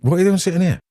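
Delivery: half-surprised, asking why he's sat by the door; wife's puzzled question. Retold or imitated. Wife arriving, surprised to find him by the door, mildly puzzled. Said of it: "And she turns up and says".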